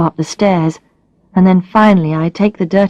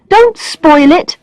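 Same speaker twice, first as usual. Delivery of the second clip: quick plea; 10 semitones higher, 5 dB louder, much slower than usual. Acted. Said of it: "I say quickly".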